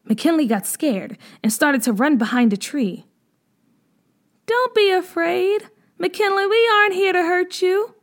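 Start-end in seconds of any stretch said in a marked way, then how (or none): 4.5-8.0 put-on calm the frightened girl from a distance; gentle stranger. A narrator's reading. Tribe girl reassuring hiding McKinley, higher gentle voice. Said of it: "one of the voices said".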